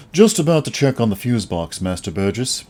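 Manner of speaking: put-on courteous posh English accent; high society accent. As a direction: serve it up like a butler to royalty. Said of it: "His accent is now high society".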